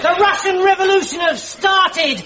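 high, thrilled proclamation; excited announcement. Acted. Private telling captain of the Russian Revolution, excited. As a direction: proclaim it like momentous news.

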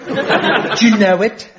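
slow, put-on English accent; unique accent. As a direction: draw out a dodgy English accent. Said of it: "it has this unique accent".

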